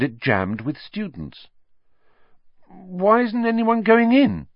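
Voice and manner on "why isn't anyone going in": high, lightly voiced curious boy; curious Ron. A narrator's reading. A boy asks the crowd, curious and high. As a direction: ask it like a puzzled young boy. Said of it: "said Ron curiously".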